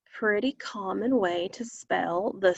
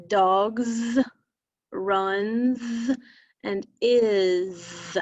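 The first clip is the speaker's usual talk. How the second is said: slow demo; much slower than usual. Shown as a demonstration. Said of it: "say those words slowly".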